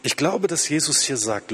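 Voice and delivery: usual speaking voice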